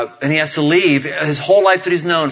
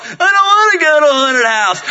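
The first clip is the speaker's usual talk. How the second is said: high, loud mock wail; complaining little girl; 12 semitones higher, 5 dB louder than usual. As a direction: wail like a scared six-year-old refusing. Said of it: "She's complaining".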